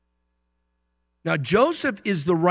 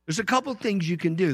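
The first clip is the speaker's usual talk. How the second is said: ordinary talk, nothing marked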